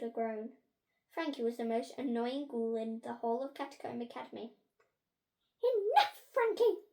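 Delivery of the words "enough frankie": high, loud teacher's reprimand; losing patience. A narrator's reading. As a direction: cut the pupil off impatiently. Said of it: "losing patience".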